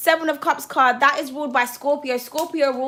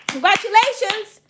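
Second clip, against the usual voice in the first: higher and louder, beaming; excited congratulations; 8 semitones higher, 6 dB louder than usual. Real, not acted. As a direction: cheer it out warmly. Repeats congratulations bigger.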